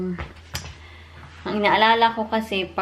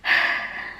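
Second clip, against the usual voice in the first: a tired unvoiced sigh out; weary sigh; 3 dB quieter than usual. Real, not acted. Vlogger sighing over her rejected paper, weary sigh. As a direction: let the stress out in one long breath. Stressed about resubmitting paper.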